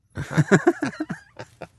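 high bright burst of laughter; hearty laugh. Real, not acted. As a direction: laugh out loud with delight. Grandfather laughs about the bell.

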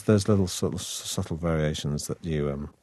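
ordinary delivery

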